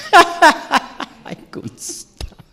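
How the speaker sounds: high, stretched words dissolving into laughs; helpless laughter. Real, not acted. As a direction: squeak it out through laughter. Laughing while retelling her wailing.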